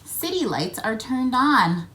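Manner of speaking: usual speaking voice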